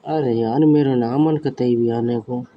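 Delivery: ordinary delivery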